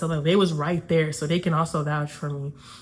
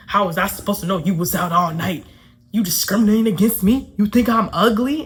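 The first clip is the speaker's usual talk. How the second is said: louder, indignant retold challenge; mock offended; 5 dB louder than usual. Dude accuses her of discriminating, mock offended. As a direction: imitate an aggrieved guy demanding answers. Quotes the man's offended complaint.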